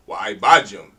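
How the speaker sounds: Wa Ibadjum with Wa hit loud and set apart; emphasized Wa. Shown as a demonstration. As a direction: punch Wa, then Ibadjum. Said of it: "It would have to be emphasized".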